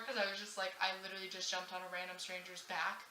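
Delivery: usual speaking voice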